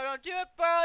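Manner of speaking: loud, high pleading yell; urgent yell. Real, not acted. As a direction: shout the warning at the screen. Repeated warning, much louder.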